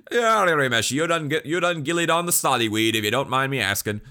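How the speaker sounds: shouted mock Irish-Scottish accent; loud, high, garbled Irish-Scottish brogue; Gene. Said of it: "Gene's stupid accent going".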